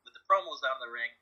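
ordinary talk, nothing marked

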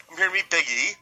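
all offended. Retold or imitated. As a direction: act affronted at being asked. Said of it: "like, all offended".

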